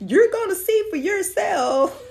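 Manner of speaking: high, loud, laughing tease; teasing repeat. Real, not acted. A vlogger repeating the tease to viewers, laughing. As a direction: say it again through a grin.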